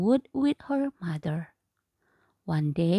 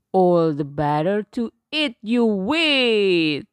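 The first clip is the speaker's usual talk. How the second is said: wolf shout; 2 semitones lower, 6 dB louder than usual. Acted. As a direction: shout it like a hungry beast. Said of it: "shouted the wolf".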